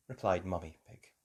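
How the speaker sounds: ordinary delivery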